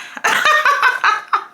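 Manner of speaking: high burst of laughter; real laughter. Real, not acted. Laughing after owning up to cursing, real laughter. Laughs again at her confession.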